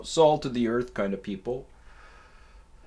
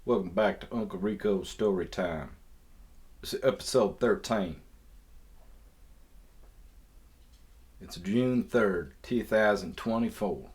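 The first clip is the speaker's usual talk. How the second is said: hammy put-on Southern accent, laid on thick. Podcaster opening his show in a deliberately horrible Southern accent. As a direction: play a bad Southern yokel accent. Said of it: "why I'm talking with this horrible southern accent".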